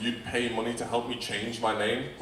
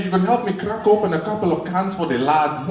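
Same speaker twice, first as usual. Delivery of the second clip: much higher, louder mock old Japanese man; Nelson Mandela; 8 semitones higher, 6 dB louder than usual. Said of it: "sound like an old Japanese man".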